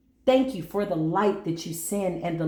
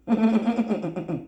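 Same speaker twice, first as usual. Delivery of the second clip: short real laugh; 3 semitones higher than usual. Real, not acted. Laughs after her own remark.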